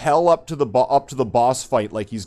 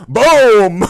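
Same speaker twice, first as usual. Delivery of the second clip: much louder, higher shouted boom; loud punch boom; 8 semitones higher, 11 dB louder than usual. Retold or imitated. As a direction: punch sound effect. Acting out a knockout punch.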